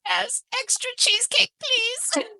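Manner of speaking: put-on high, soft little-girl voice begging for cheesecake; cutesy high voice. Answering her fiance's ice cream offer in a cutesy high voice. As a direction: sweet-talk her fiance like a greedy child.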